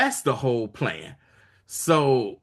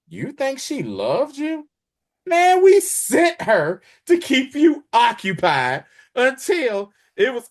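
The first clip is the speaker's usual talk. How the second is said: taunting; 11 semitones higher, 5 dB louder than usual. Retold or imitated. Valia taunting the emperor.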